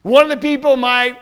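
sneering royal put-down; sarcastic. Acted. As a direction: look down on him with mock curiosity. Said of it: "in that kind of sarcastic way".

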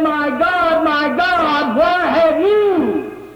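high, long-drawn anguished cry; acted emotional outcry, rising at the end; screaming out. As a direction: cry to heaven in pain, stretching the words. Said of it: "He was screaming, he was crying".

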